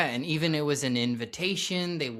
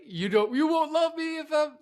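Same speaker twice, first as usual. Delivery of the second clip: pitched way up, a bit louder, pleading line from the film; pleading movie character; 4 dB louder than usual. Retold or imitated. Host quotes Good Will Hunting scene, pleading high voice. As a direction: quote the scene like the desperate lead. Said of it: "The one where he's like".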